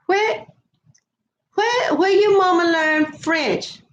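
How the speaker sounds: high, drawn-out mock bafflement; retold astonishment. Residents asking about mama's French, imitated in high astonishment. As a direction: imitate puzzled onlookers, pitched well up and stretched out.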